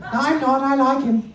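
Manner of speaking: very high wife puppet retort; exaggerated high female character; feminine voice. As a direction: contradict him as the lady dummy. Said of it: "let's find a nice feminine voice".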